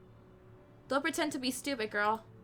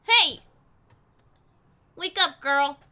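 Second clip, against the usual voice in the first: calling out; 11 dB louder, slower than usual. Acted. Shopkeeper yells at the girl sleeping outside her shop. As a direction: shout her awake from the doorstep.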